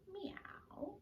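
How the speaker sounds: high whispered kitty meow; soft high cat meow. Shown as a demonstration. Kitty cat stretch demo.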